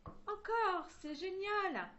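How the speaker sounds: put-on high, loud little girl's cheer; excited yell. A narrator's reading. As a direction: cry out in delight for an encore. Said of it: "La fillette hurla alors".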